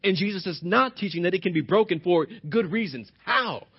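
ordinary talk, nothing marked

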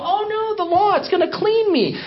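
high, mock-hopeful voice; mock naive relief. Mocking the hearers who trusted the law, high and hopeful. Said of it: "you take it and you go".

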